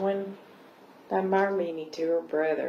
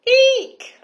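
high, loud storybook squeal; startled scream; 13 dB louder than usual. Acted. Girl startled by a voice in the shell, scream. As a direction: squeal it out in sudden fright. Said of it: "I screamed".

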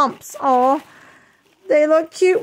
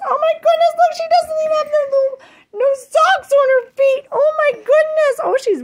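excited cooing; 13 semitones higher than usual. Real, not acted. Vlogger meeting her new baby doll, excited cooing. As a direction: squeal and coo as if over a newborn.

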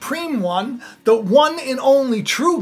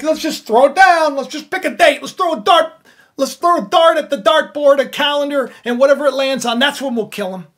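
mocking careless plotters, higher and louder; 5 semitones higher, 7 dB louder than usual. Preacher mocking the enemies' careless plot, higher and louder. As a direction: toss off the plot like a game. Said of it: "You're like".

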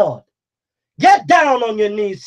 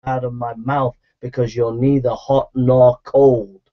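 much lower, slow and solemn, like God speaking; 11 semitones lower than usual. Acted. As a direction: hand down the verdict as God himself. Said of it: "God says to you".

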